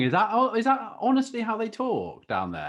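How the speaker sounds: real amused disbelief; amused surprise. Real, not acted. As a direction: gasp at how strong it sounded. Surprised by the strong accent.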